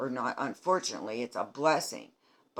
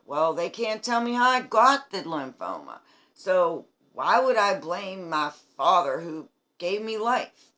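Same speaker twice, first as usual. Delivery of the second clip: emphatic; 5 semitones higher, 7 dB louder than usual. Real, not acted. Defending herself against critics.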